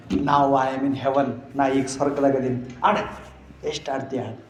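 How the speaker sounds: tender father. Acted. Father speaks tenderly to daughter.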